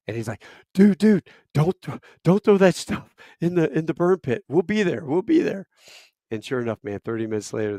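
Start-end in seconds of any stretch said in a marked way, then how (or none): 0.7-5.7 high, loud mock panic; alarmed, stammering plea in a raised voice; pleading doc. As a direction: imitate frantic begging, pitched well up. Imitates doc pleading on phone.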